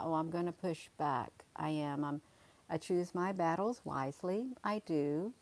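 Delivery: usual speaking voice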